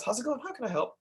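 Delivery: high, soft, helpful greeting; friendly greeting. Retold or imitated. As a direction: greet the caller sweetly. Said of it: "Which means".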